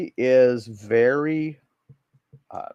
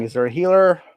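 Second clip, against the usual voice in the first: yelling; 6 semitones higher, 6 dB louder, faster than usual. Acted. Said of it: "they're yelling".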